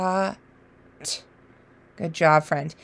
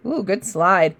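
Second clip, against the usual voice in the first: normal talking voice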